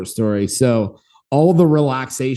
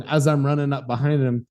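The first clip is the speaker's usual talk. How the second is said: normal talking voice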